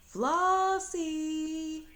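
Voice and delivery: high, loud call across the yard. Acted. Grandmother calls child from far away, loud high call. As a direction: call her name from far off.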